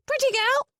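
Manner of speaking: very high, piercing parrot squawk. Acted.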